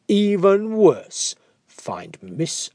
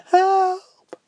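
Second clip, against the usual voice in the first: much higher, louder tiny cry; cry for help; 5 dB louder than usual. Acted. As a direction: call out from inside. Family trapped in fridge.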